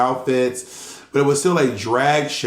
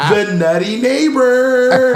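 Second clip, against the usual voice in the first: loud, high, drawn-out mock announcement; sitcom crowd cheer; 10 semitones higher, 6 dB louder, much slower than usual. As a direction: stretch it out like a roaring ovation. Imitates sitcom ovation for wacky neighbor.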